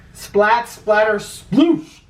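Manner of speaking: playful sound effects; splashy sound words. A narrator's reading. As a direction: savour each splash noise. Reading sound words with relish.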